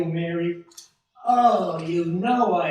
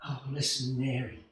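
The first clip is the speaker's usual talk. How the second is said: much softer and lower, intimate; hushed tender; 7 semitones lower, 8 dB quieter than usual. Acted. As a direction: say it close to her ear. Whispering close to his wife.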